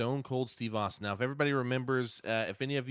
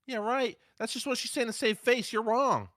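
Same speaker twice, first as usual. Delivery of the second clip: mock disbelief; 12 semitones higher, 4 dB louder than usual. Mocking disbelief after debunk.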